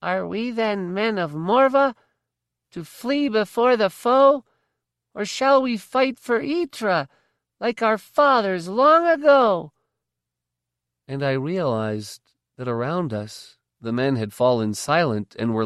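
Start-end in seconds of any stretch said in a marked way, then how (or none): 0.0-9.7 thin little voice. A narrator's reading. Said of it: "piped out bravely in his thin little voice".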